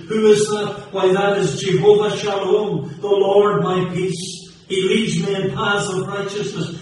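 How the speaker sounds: loud, high, ringing preacher's cadence; preaching at full force. Acted. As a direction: hammer each name of God home to the congregation.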